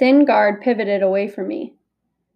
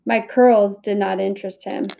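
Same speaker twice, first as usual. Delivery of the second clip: usual speaking voice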